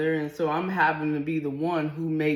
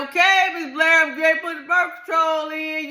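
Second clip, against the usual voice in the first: high, loud holler; 12 semitones higher, 7 dB louder than usual. Retold or imitated. Doctor hollers to the husband, high and loud. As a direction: holler it across the room. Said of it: "she hollered it out".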